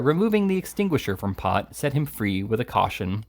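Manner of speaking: ordinary delivery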